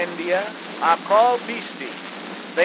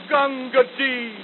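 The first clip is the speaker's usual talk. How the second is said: rising urgent plea; 8 semitones higher than usual. Acted. Wounded soldier begging for water, building urgency. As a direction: build to a desperate cry for water.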